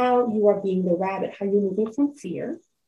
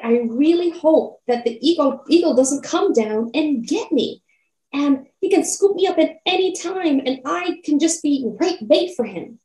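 higher and louder, frantic; louder, terrified rabbit; 5 semitones higher, 5 dB louder than usual. A narrator's reading. Rabbit screaming its fear a third time, frantic. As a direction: cry it out in terror. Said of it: "he said even louder, because he was so afraid".

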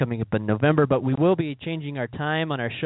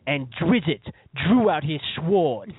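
Ice-T; 4 semitones higher, much slower than usual. Retold or imitated. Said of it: "he's like".

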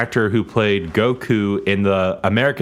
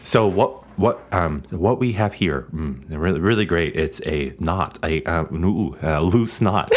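low, soft, halting cadence with hums; Jeff Goldblum; 6 semitones lower, 3 dB quieter, slower than usual. Acted. Presenting a knot as the actor, low halting impression. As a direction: hesitate and murmur, dropping low between fillers. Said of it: "I can't do his voice".

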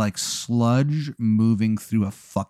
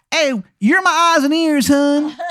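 very high, loud Baltimore wizard; terrible Maryland accent; 6 dB louder than usual. Acted. Wizard makes Adam his spy, terrible Maryland accent. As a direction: launch into a squawking, overdone Maryland accent. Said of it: "it is a terrible Maryland accent".

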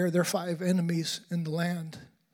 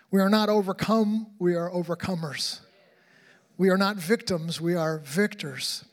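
fiery pulpit cry, then settling on victors; preaching at full force; 2 semitones higher, 4 dB louder than usual. Real, not acted. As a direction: build to a triumphant declaration. Preaching climax, rising force.

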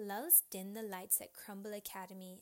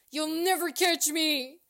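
loud, raised-pitch escape cry; defiant shout; 7 semitones higher, 14 dB louder than usual. A narrator's reading. Teacher fleeing the officer, loud defiant shout. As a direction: yell it while making a break.

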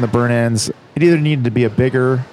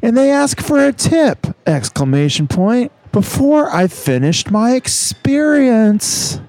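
surfer bro voice; 9 semitones higher, slower than usual. Acted. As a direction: complain like a riled beach bro. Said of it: "a beach bro".

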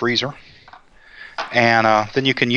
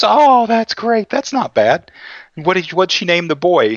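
mock-relieved, much higher, slow; a hammed-up sigh of relief, pitched up high; relieved mother; 7 semitones higher than usual. The new mother tells the doctor she's relieved, in a high, slow voice. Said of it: "And she goes".

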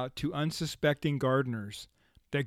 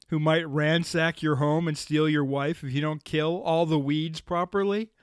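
usual speaking voice

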